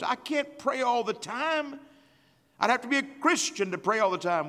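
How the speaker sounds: lightly voiced complaining churchgoer; mock excuse. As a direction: mimic the lazy excuse.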